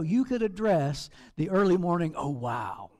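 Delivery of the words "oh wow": low, soft, dismayed "Oh, wow"; a low, mock groan at your own reflection. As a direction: groan at the morning face. Mocking the morning mirror face.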